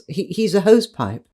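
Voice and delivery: usual speaking voice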